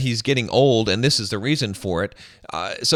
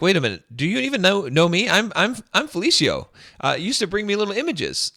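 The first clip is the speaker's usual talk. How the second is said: higher-pitched retold protest of a forgotten servant; mock indignant protest; 6 semitones higher, 3 dB louder than usual. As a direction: act out being baffled at not being recognised. Retells slave protesting he is unrecognised.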